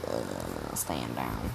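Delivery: very low, soft authority figure; stern order. Acted. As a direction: drop deep and quiet to halt him. Teacher stops a running student.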